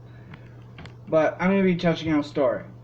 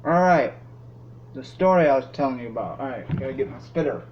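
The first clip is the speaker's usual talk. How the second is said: mouth full of dip; 4 dB quieter than usual. Real, not acted. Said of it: "Got dip in my teeth".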